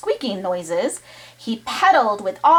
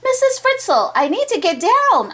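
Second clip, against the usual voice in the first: shouted plea; 11 semitones higher than usual. Acted. Arnold calling high to Missus Fritzl to get him down. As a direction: call out high to the teacher for help. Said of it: "Arnold shouted".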